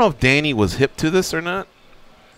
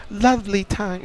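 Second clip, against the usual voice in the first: cheerful New Zealand accent attempt, higher and soft; higher, softer mock Kiwi lilt, drifting British; Jamie; 6 semitones higher, 7 dB quieter than usual. Host imitating caller's cheerful wife, higher Kiwi-British accent. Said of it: "the accent is just".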